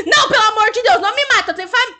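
implora, mais alto e agudo; súplica fingida. Pretend. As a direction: finge medo do assassino. Begging killer in game. (súplica de medo fingido)

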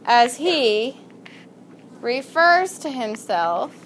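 word by word, drawn out with long pauses; slow dictation. Shown as a demonstration. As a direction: dictate slowly for students writing it down. Dictates a sentence to the class.

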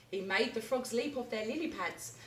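plain reading voice

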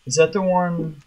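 normal talking voice

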